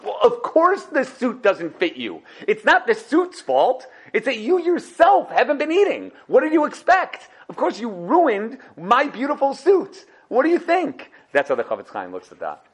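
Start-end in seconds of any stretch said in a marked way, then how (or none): 0.0-11.1 high, indignant tailor scolding, slow at first. Acted. Tailor scolding a starving customer, high indignant rant. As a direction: let the tailor rant, half laughing.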